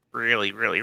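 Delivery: normal talking voice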